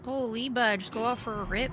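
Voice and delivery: put-on Canadian accent. Streamer clowning while playing, Canadian accent. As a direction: play the broad Canadian bud for laughs. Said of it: "I have to use my Canadian accent".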